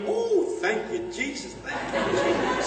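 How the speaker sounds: very high, thrilled cry of thanks; an overjoyed grandmother thanking Jesus; joyful praise. Retold or imitated. Grandparents praising at student's news.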